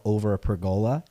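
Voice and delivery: "pergola" said per-GO-la; long-O pergola. Real, not acted. Telling of grandma's grapes, "pergola" as per-GO-la. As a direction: stress the middle of "pergola", with a long O. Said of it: "Is a pergola like a pergola?".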